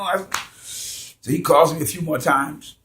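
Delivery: normal talking voice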